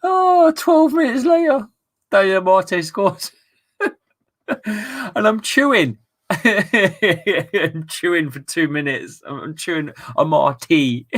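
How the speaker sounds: words squeezed out through laughter; telling it while cracking up; laughing. Real, not acted.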